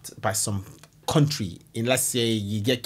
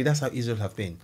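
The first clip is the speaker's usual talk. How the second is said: ordinary talk, nothing marked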